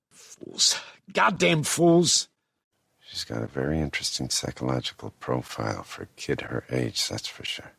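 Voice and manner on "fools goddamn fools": loud, high, drawn-out cursing; angry curse. Acted. Cursing the fools who would not wait, angry. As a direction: curse them slowly and loudly. Cursing after the urgent orders.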